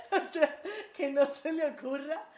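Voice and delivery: real loud laughter spilling into the words; bursting with laughter; laughing hard. Real, not acted. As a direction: laugh through the warning. Laughing at her own joke.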